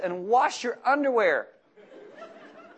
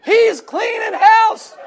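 much higher and louder, slow and laughing; big shout; 12 semitones higher, 12 dB louder, much slower than usual. Real, not acted. Preacher to congregation, a slow laughing shout. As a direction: belt the punchline.

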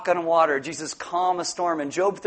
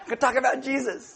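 usual speaking voice

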